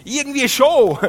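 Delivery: high, loud yell; yelling back over loud music; shouting back. Retold or imitated. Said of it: "ich habe dann so zurückgeschrauen".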